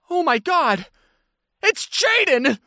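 high, gushing imitated squeal; starstruck fan. Fan spotting the speaker's friend, excitedly imitated.

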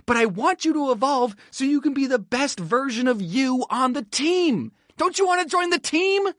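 high, building appeal; eager pleading. Acted. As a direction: coax with rising, overeager pitch. Pleading with Mister Flash.

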